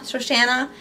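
normal talking voice

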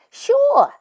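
sure, tossed up high and loud; eager kid answering; eager and pleased; bright eager yes. A narrator's reading. Millie eager reply.

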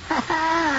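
a soft, high delighted laugh; delighted chuckle. Acted. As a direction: chuckle with quiet pleasure. Father hears of the wedding.

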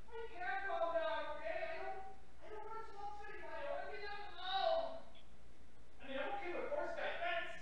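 put-on high, soft, sulky girl's voice; upset girl. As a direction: insist you want to be left alone. Pesky still upset.

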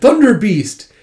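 high, loud indignant call; exclaimed name. Retold or imitated. Lord to the man who rescued him, exclaimed. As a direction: burst out with the nickname.

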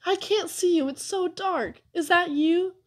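put-on high-pitched small boy's voice; small child's anxious questioning; little brother. A narrator's reading. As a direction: call out high, unsure in the dark. Small brother calling into darkness.

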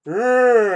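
loud growl up high; growling monster roar. Retold or imitated. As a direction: snarl it like a cyclops. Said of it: "He's like".

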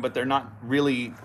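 ordinary talk, nothing marked

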